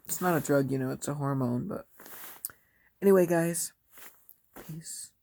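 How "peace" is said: soft whispered goodbye; whispering. Real, not acted. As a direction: whisper it, drifting off. Whispered sleepy sign-off.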